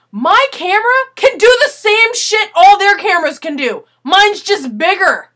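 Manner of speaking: seething at the unfair rule; angry inner rant. Retold or imitated. Silently fuming at the guard over the camera, loud indignant rant. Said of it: "In my head, I'm going".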